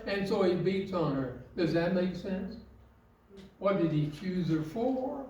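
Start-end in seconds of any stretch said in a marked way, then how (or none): none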